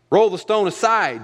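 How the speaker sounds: loud, high command to move the stone; commanding call. Acted. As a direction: order it out with force. Jesus commands at the tomb.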